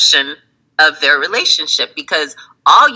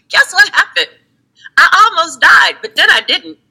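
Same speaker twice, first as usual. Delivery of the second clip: very high, chirpy, laughing at the end; breezy past self; 12 semitones higher than usual. Retold or imitated. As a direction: cheerfully shrug off a near disaster. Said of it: "I'd call you up and be like".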